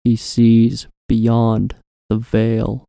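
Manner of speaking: very slow, deliberate and ominous. Acted. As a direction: drag out each word ominously. Repeated slower, more intense.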